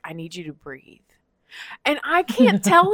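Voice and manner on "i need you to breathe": calm soothing. Retold or imitated. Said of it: "he was like".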